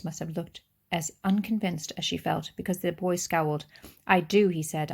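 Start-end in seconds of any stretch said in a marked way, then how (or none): 4.1-4.5 insistent. Acted. Boy scowls, insisting rooftops his.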